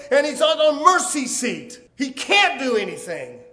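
high, shouted pulpit challenge, peaking on the refusal; full-force preaching. Real, not acted. Preacher challenging congregation on what God can do, shouted preaching. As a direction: hammer the argument home at a shout.

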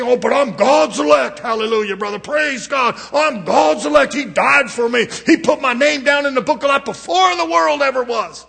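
loud, high mock-holy bragging; mock pious boasting. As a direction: parody a self-righteous churchgoer. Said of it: "you can say all the right thing".

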